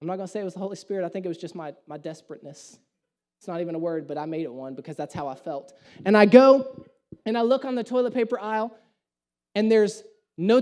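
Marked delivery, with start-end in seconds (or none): 0.0-5.7 quiet aside. Real, not acted.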